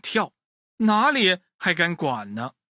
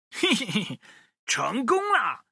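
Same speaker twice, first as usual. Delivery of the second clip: sly triumph; 6 semitones higher than usual. Acted. Woodcutter sneaks in successfully.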